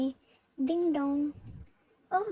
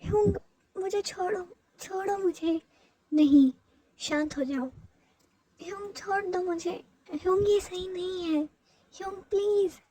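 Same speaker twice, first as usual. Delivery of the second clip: frightened pleading; 4 semitones higher than usual. Acted. Jungkook pleading with his hyung to let him go, frightened. Jungkook struggles against forced hug.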